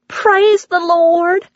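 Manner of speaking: waitress stunned by the reunion story; awed waitress. Acted. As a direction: gasp it out like a small miracle. Waitress hears the adoption tale.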